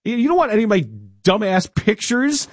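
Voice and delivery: high, mocking scorn at the scammer; mock the thief's pointless prize; sarcastic rant. Host ranting at the scam caller, high and sarcastic.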